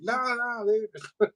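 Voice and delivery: squeaky high panicked no no; nervous high refusal. Retold or imitated. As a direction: back away in a high squeak. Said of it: "And I'm like".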